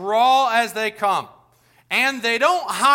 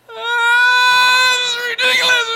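high, loud screaming wail; shrieking mock outrage; mock adult tantrum; 9 dB louder, slower than usual. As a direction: throw a hammed-up toddler fit as a grown-up. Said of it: "we get all mad and stomp fists".

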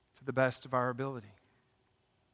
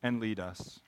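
prayer; 4 semitones lower than usual. Real, not acted. Closing prayer.

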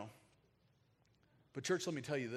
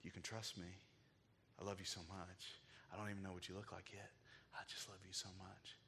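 put-on soft, hushed words to a baby; tender hush; 4 semitones lower, 11 dB quieter than usual. Father softly telling his unborn baby he loves her. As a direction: murmur love to the child in the womb.

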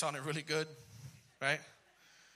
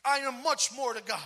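loud, high preaching burst; 8 semitones higher, 12 dB louder than usual. Acted. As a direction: proclaim it to the congregation. Preaching at full force.

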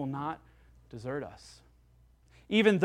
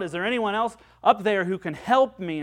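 raised voice, calling up a cliff; calling out for help; 3 semitones higher, 7 dB louder than usual. Acted. Man hanging from a branch calls up, a raised call for help. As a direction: call up for someone to help you. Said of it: "finally he called out".

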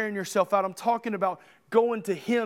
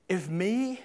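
ordinary delivery

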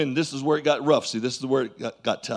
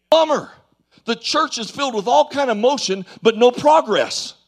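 ordinary talk, nothing marked

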